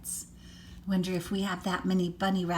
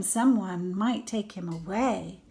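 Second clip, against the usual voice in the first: usual speaking voice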